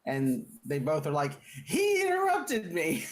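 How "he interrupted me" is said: much higher, slow whine; whiny captives. Acted. Both captives complain to the witch, high whiny tattle.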